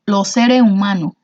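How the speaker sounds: Los seres humanos in a Cuban accent, final s dropped, lo sere umano; Los seres humanos con acento cubano, sin las eses. Shown as a demonstration. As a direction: drop the s sounds like a Cuban would. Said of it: "cómo lo pronunciaría un cubano".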